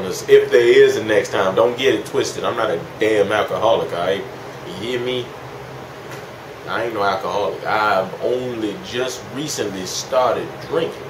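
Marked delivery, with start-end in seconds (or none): none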